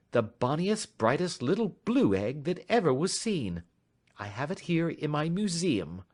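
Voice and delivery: polite and in command; composed detective. A narrator's reading. Detective inviting the stranger to talk, lightly voiced calm.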